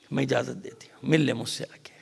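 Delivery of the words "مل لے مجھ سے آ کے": a hushed, grave narration; hushed whisper. A narrator's reading. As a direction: draw the room in with a whisper. Hushed scene of visitors arriving.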